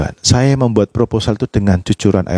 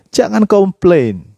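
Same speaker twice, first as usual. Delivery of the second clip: high, drawn-out preacher's command; emphatic exhortation; 8 semitones higher, slower than usual. Real, not acted. Preacher urging the congregation not to complain, emphatic high exhortation. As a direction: proclaim it slowly, pitched up.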